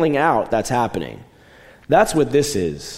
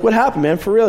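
much higher and louder, pushing for an answer; insistent demand; 10 semitones higher, 5 dB louder than usual. Retold or imitated. College student pressing friend about the bandaged thumb, loud and insistent. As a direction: plead loudly with your buddy to spill it.

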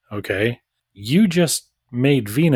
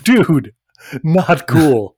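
indignant; 3 semitones higher, 7 dB louder, much slower than usual. Retold or imitated. Mock indignant prisoner.

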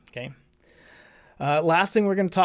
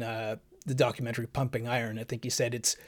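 ordinary talk

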